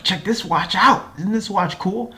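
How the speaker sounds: high, proud boast of a new toy; eager son showing off his watch. Retold or imitated.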